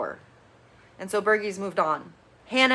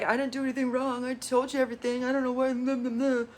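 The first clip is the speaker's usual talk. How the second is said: higher, softer mocking whine; mock defensive whine; 4 semitones higher, 4 dB quieter than usual. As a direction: brush it off like a guilty boyfriend. Kenan's defensive reply, mocked.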